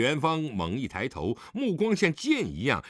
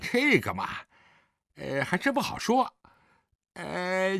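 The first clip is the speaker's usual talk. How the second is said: smug, provoking son in a higher voice; provocative; 8 semitones higher than usual. Acted. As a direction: needle your father with showy hesitation. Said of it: "狄景辉挑衅地说".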